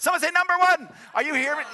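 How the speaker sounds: high, loud congregational prompt; rousing shout. Real, not acted. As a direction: shout it out to get the crowd responding.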